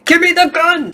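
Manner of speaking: loud, high mock desperate yell; imitated yell. Reviewer quoting grown man demanding the gun, yelled imitation. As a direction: mimic a grown man yelling at full volume. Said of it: "yelling at the top of his lungs".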